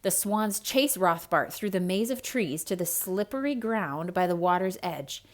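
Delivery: ordinary delivery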